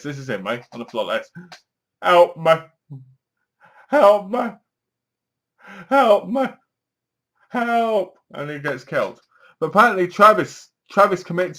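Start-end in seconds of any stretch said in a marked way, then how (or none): 2.0-8.2 pleas climbing higher each time, lightly hammed-up; mock pleading for help. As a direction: beg for help from the floor, rising. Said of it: "he goes like this".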